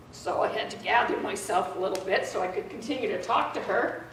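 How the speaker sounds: usual speaking voice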